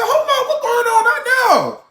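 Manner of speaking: high, frantic cry of alarm; panicked shout. Retold or imitated. Scared man yelling through the thin wall in panic. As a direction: shriek it out, scared stiff.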